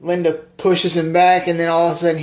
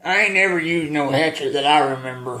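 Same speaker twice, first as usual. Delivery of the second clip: movie character impression. Retelling a hatchet question's answer from the movie, in character voice. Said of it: "And he says, uh".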